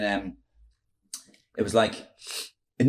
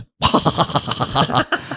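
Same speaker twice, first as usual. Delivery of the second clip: high staccato mock laugh; Roy Keane laugh; 5 semitones higher, 4 dB louder than usual. Footballer breaks at the comic's nonsense, imitated cackle. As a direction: burst into a put-on cackle. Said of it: "And Roy went".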